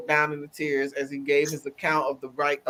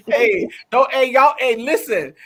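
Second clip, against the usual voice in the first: loud, high call to the audience; loud, higher shout-out to listeners; 8 semitones higher, 10 dB louder than usual. Real, not acted. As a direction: call out to everyone. Calling out to audience.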